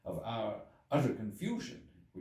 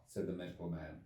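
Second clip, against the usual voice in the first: plain reading voice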